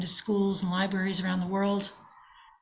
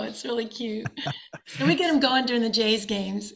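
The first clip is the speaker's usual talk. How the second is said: ordinary talk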